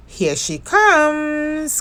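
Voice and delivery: excited cry. Acted. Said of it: "cried Bear".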